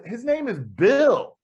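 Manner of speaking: slow, high retold protest; acted incredulous correction, drawn out; exasperated correction. Retelling her correction of the name.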